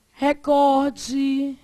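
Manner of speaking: "recorde" drawn out, stressed on COR; careful prosody reading. Shown as a demonstration. Teacher reading prosody examples to the class, middle-syllable stress. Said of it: "lê isso aqui pra mim".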